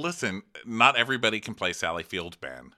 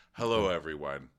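lower, softer, hushed bowing voice; actory greeting; 6 semitones lower, 8 dB quieter than usual. Retold or imitated. Imitating Harry's actorly entrance to the women. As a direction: bless them like a pompous actor. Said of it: "comes in so actory".

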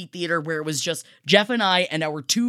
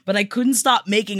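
ordinary talk, nothing marked

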